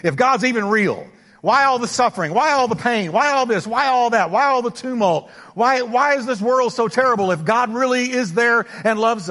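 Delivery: a skeptic hammering his questions; doubter's complaint. Retold or imitated. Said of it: "I talk to people sometimes like".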